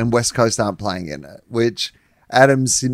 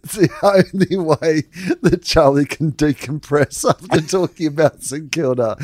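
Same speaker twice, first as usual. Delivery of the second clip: ordinary talk, nothing marked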